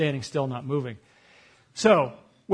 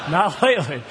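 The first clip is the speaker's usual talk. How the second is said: repeated with a laugh, louder; 5 dB louder than usual. Real, not acted. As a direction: chuckle the words out.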